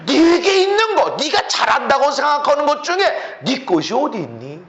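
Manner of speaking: high, loud acted exchange; impassioned preaching. Preacher voicing God answering a boastful man, forceful.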